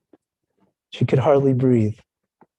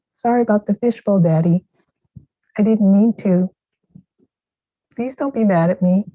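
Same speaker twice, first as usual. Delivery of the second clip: put-on higher, small child's apology; tearful little girl; 8 semitones higher than usual. Daughter apologises to her father about the fishbowl, high and childlike. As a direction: plead like a scared little girl. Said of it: "her eyes welling with tears".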